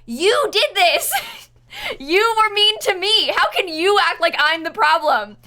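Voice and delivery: high, loud exasperated telling-off; indignant rant. Real, not acted. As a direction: scold the troll in disbelief. Indignant rant at banned trolls.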